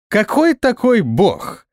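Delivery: slow, high-pitched grumpy query; displeased. A narrator's reading. Small flyer doubts the new god, displeased. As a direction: question it with drawn-out, high disbelief. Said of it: "раздался недовольный голос".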